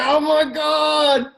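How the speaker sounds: high, slow, mock-despairing oh my God; exasperated groan. Laura banging her head on the wall, mock exasperated. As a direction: imitate a colleague's eye-roll. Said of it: "She's like".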